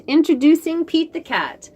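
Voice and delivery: plain reading voice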